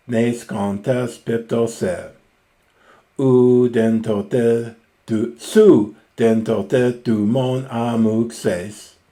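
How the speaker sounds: slow, loud, deliberate reading of the Homeric line; Greek recitation. Acted. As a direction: chant the verse out slowly, weighing each word. Reads Homer aloud slowly.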